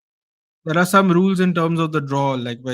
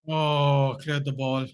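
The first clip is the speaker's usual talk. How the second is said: usual speaking voice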